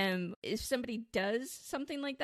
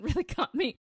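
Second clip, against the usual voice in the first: ordinary delivery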